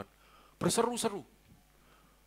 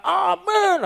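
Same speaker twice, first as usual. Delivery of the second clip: high, loud held exclamation; calling out amen; 14 semitones higher, 5 dB louder than usual. Real, not acted. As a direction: ring it out far above speech pitch. Calls out amen after the song.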